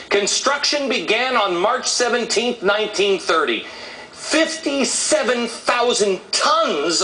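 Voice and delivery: high-pitched, rousing rant with stretched key figures; fired-up, emphatic public speaker; impassioned oratory. Real, not acted. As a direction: hammer out each fact like a rally speech. Impassioned televised monologue.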